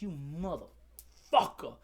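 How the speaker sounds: slow, low seething curse; mad retold curse. Student moved away from his girlfriend, seething. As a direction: draw the curse out low, splitting it in two. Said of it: "how fucking mad that made me".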